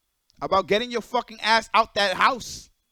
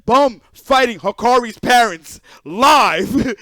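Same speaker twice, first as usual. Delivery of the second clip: ordinary talk, nothing marked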